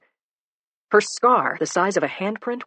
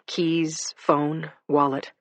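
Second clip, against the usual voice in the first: ordinary delivery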